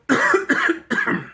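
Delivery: hard choking cough; coughing fit. Real, not acted. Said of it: "I'm choking".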